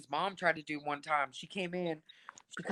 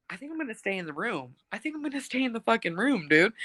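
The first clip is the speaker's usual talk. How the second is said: nervous quick aside; 4 semitones higher, faster than usual. Retold or imitated. Retold fear, deciding to stay.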